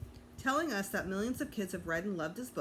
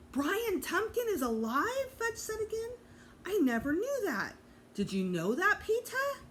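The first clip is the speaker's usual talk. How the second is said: put-on high, excited disbelief from a small boy; amazed child; 12 semitones higher, 3 dB louder than usual. A narrator's reading. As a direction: squeal it like you can't believe it. Fudge amazed, repeating the news.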